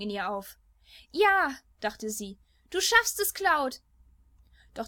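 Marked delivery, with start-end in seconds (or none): 1.1-1.6 high, loud burst of hope; hopeful cheer. A narrator's reading. Tifa's hopeful inner cheer. 2.7-3.8 high, loud urging cry; will him on with hope; hopeful cheer. A narrator's reading. Tifa's hopeful inner cheer.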